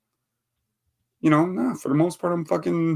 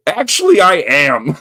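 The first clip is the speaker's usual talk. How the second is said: scared reply; 6 semitones higher, 7 dB louder than usual. Retold or imitated. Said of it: "And I'm like".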